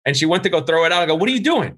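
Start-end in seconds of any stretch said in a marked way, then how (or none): 1.2-1.7 high, startled disbelief; as if catching her red-handed; shocked question. Retold or imitated. Catching wife throwing cheese out.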